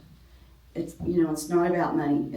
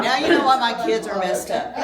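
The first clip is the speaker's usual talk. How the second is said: higher and louder, laughing through it; 6 semitones higher, 7 dB louder than usual. Real, not acted. Preacher joking with the congregation, laughing. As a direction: laugh as you confess it.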